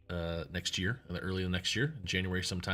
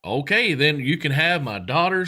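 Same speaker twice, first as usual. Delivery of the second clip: much higher, louder amazed Texan voice; excited rich Texan; 5 semitones higher, 6 dB louder than usual. Retold or imitated. Rich Texan congratulates the swimmer, high and amazed. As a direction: gush like a stunned rich host.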